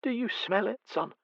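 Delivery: the fretful mother at night; anxious blind mother. Acted. Mother asks son.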